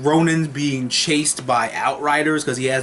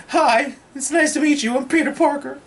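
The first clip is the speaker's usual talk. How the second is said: put-on much higher, eager sweet kid voice; cute Peter Parker; 10 semitones higher than usual. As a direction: chirp it like an excited teenager meeting a hero.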